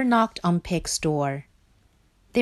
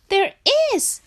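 high, emphatic little voice; insistent scared Frog; 4 dB louder than usual. Acted. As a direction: protest high and frightened. Frog insists, still frightened.

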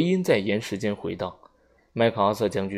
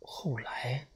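breathy whisper, much softer; changed voice; 3 semitones higher, 12 dB quieter than usual. A narrator's reading. Vera, shaken, whispering her question to the general. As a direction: let the word fall into a whisper. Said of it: "薇拉的声音变了".

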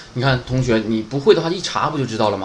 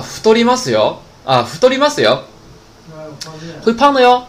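friendly warning; 3 semitones higher, 4 dB louder than usual. Shown as a demonstration. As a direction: caution them in a raised sing-out tone. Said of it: "表示哎提醒他一下是吧".

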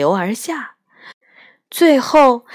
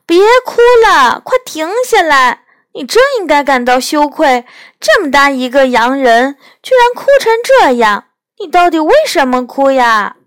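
much higher, louder scolding; scolding shout; 7 semitones higher, 8 dB louder, faster than usual. A narrator's reading. Lucy shouting at the crying faun to stop, loud scolding. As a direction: shake him out of it with a loud scold.

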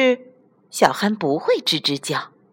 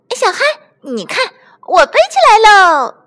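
very high, loud, gleeful call; elated shout; 13 semitones higher, 7 dB louder, faster than usual. Acted. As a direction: shout it as if soaring. Toy shouts while bouncing high. (兴奋地尖声欢呼)

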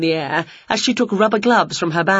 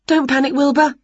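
higher-pitched reassuring Winnie; pitched up, steadying a friend; 7 semitones higher than usual. A narrator's reading. Winnie reassures the transformed Wilbur, higher voice.

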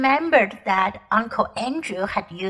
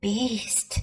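muttered under the breath, much softer; 3 semitones lower, 8 dB quieter than usual. A narrator's reading. Polly muttering at the queen, disgusted and hushed. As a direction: mutter the insult, barely audible. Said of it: "muttered Polly".

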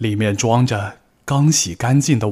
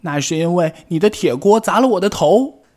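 high, louder answer that won't back down; defiant retort; 9 semitones higher, 5 dB louder than usual. Acted. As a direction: hit back just as hard. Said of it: "不甘示弱".

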